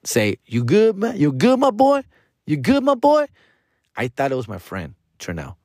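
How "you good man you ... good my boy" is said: much higher-pitched acted check-in; high rising questions in a put-on voice; imitated stranger's voice. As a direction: act out a man calling over, pitched way up.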